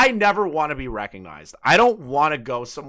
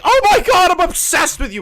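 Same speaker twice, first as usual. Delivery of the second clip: very high, loud mock squeal; gushing fan; 9 dB louder than usual. Obsessed fan meeting the streamer, mock squealing. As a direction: shriek it like an obsessed fan, hammed-up.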